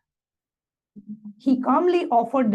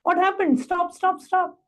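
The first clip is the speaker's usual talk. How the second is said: retold shouting; 7 semitones higher than usual. Disciple retelling his shock as the boulder rolls, mock shouting. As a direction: imitate panicked shouting, pitched well up. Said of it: "I started shouting".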